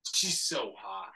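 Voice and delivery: normal talking voice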